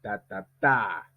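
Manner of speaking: slow "ta ta TA", last beat stressed; final-stress rhythm drill. Shown as a demonstration. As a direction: tap out "ta ta ta" with weight on the final one. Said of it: "On dit". (« ta ta TA » lent, accent sur le dernier)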